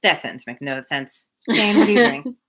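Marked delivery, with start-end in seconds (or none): none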